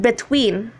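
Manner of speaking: beTWEEN, slow, second syllable stressed. Shown as a demonstration. Teacher reads the example between, stressed on the second syllable. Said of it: "the stress is on the second syllable".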